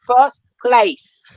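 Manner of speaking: light Jamaican lilt, proud boast; Jamaican accent. Pretend. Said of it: "You're getting that Jamaican accent out of me".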